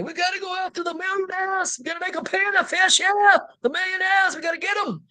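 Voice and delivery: hammed-up, high-pitched stump rant in mock accent; Bernie Sanders. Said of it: "you hear Bernie".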